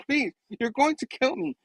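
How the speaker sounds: high, quiet mock pleading; Mysterio. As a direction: beg for mercy in a small high voice. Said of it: "And then Mysterio's like".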